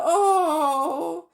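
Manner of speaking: loud high shocked "Oh"; startled gasp. Acted. Children startled by Uncle Andrew, loud high gasp. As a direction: cry out in sudden alarm. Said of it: "Both children said".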